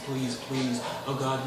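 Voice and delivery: ordinary talk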